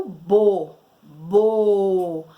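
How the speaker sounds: stretched, emphatic "booo"; drawn-out syllable. Shown as a demonstration. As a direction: sound out "bo" slowly and firmly. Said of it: "associar o som de cada uma delas".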